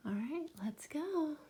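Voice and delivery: hushed start to the story; whisper. Real, not acted. Coaxing sleepy child.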